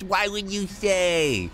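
high, slow put-on innocent voice; naive Jay. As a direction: imitate a naive, clueless victim. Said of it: "He would say how much money he has".

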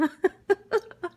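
short, high bright laugh; laughing about cooking so much. Real, not acted. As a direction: burst out laughing. Laughing at herself.

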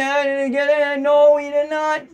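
live call, rising with the play; excited play-by-play. Real, not acted. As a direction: voice climbing high as the runner goes. Live call of goal-line run.

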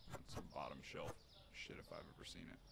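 soft low whispered joke; muttered aside. Pretend. Streamer joking about the bottom shelf, soft and low. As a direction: mutter it under your breath.

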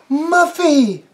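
high, loud squeal of the name. Acted. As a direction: squeal in happy shock. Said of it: "she squealed".

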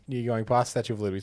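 usual speaking voice

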